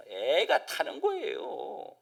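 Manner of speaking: ordinary talk, nothing marked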